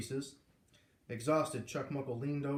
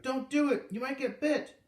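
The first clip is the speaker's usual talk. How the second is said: much higher, louder shout of alarm; shouted warning; 12 semitones higher, 6 dB louder than usual. A narrator's reading. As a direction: yell it to stop him. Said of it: "Beatrice shouted".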